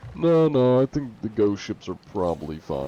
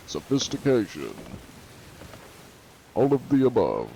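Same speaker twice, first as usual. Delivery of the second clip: ordinary talk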